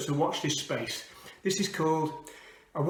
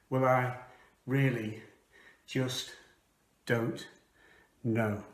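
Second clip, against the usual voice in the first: halting; 3 semitones lower, slower than usual. Acted.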